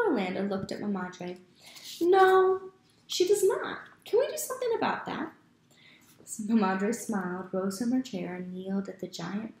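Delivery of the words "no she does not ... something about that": high, fast, joking voice; playful uncle. Acted. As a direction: tease along with the kids.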